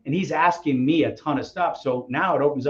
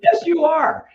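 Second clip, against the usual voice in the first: loud, very high comic retort; heated agreement; 5 dB louder, slower than usual. Retold or imitated. Novice insists coach is what he called himself, heated. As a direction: cry it out in mock outrage.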